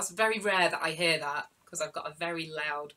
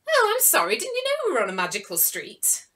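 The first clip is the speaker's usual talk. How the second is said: surprised pigeon; 8 semitones higher, 8 dB louder, faster than usual. Acted.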